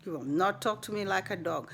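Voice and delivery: quiet defiance. Retold or imitated. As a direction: say it low and cold, no backing down. Defiant speech to absent cashier.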